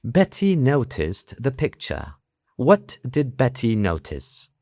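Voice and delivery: formal southern British accent, drawn out; British listening-exam RP. Shown as a demonstration. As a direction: enunciate like a posh British listening test. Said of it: "En los listenings".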